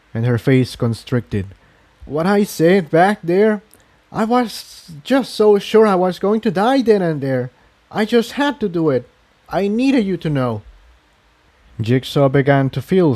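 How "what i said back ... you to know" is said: higher, earnest confession; Incendia's voice. A narrator's reading. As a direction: pitch it up and confess it.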